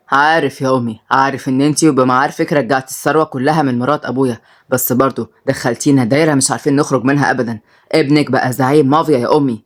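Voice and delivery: low, even voice of a grown son; weary son. Acted. Son tells his mother she trapped them, low restrained voice.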